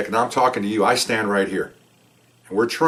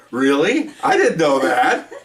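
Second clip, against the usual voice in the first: slow, amused and laughing, fake astonishment; mock surprise; 5 semitones higher, 3 dB louder, much slower than usual. Officer teasing the suspect who admits the bluff, sarcastic slow amazement. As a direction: drag it out, barely holding back a laugh.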